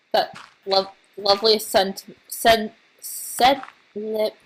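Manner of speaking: tongue-tied false starts; tripping over the word, restarting; stumbling over words. Real, not acted. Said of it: "can't talk".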